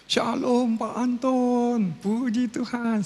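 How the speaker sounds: high, slow, drawn-out pastor's greeting; imitating the pastor. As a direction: sing out the greeting slowly like a visiting pastor. Visiting pastor greets sick man.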